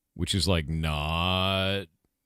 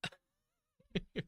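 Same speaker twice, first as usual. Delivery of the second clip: cooing to a pet; 20 dB quieter than usual. Real, not acted. Streamer cooing to his pet mouse while she sheds, soft baby-talk.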